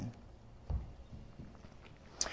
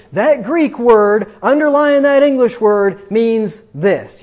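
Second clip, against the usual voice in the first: mock pronouncement; 4 semitones higher, 6 dB louder, slower than usual. Parodies what Greekifiers say.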